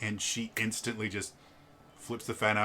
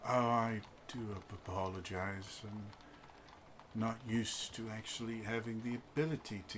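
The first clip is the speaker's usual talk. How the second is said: reserved husband; 11 dB quieter than usual. Acted. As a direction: answer with weary politeness.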